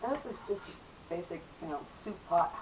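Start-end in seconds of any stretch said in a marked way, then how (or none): none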